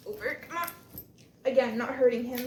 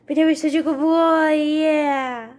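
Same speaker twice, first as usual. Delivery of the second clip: loud gushing praise, a bit higher; doggy praise voice; 4 semitones higher, 13 dB louder, slower than usual. Real, not acted. Owner telling the camera her dog was good, gushing and loud. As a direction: dote on him out loud.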